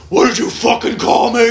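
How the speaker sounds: screaming back at the boss; furious yell. Retold or imitated. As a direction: explode at them. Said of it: "fear turns into anger".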